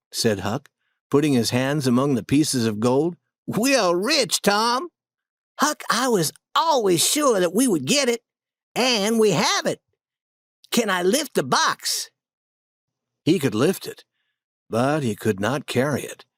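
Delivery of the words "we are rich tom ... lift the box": put-on higher boyish voice, giddy with the find; lightly excited, can't believe it; thrilled boys. A narrator's reading. The boys find the gold and rejoice, higher excited voice.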